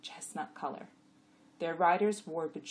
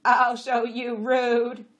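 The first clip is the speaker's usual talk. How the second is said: loud, slow, raised threat; threatening donkey; 7 semitones higher, 14 dB louder, much slower than usual. A narrator's reading. Jack about to slam the other, loud threat. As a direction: threaten loudly, dragging each word.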